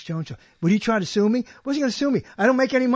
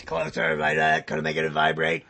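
very low vibrating throat voice; Alex Jones; slower than usual. Shown as a demonstration. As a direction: sink the voice deep and let the throat vibrate. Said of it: "Teach me how to do Alex Jones".

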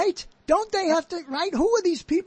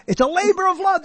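higher, louder burst as the phrase comes back; triumphant recall; 8 semitones higher, 5 dB louder, faster than usual. Real, not acted. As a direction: blurt it out, pleased to have found it. Suddenly finds the lost phrase.

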